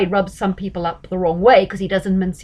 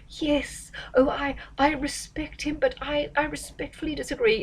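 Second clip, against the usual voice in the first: higher, much softer, hesitant politician voice; mock a timid politician hedging every word; mealy-mouthed politician; 6 semitones higher, 6 dB quieter than usual. Mimicking other politicians dodging confrontation, mealy-mouthed and soft. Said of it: "it's all this mealy-mouthed stuff".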